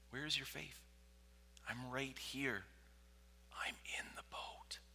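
soft reassurance sinking to a whisper; gentle, hushed Jesus. Acted. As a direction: ask it softly, then almost whisper. Jesus gently asking listener.

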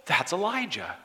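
high, loud, knowing exclamation; mock crowd certainty. Crowd eagerly naming Elijah, high and loud. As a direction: sound sure it's Elijah. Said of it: "they're like".

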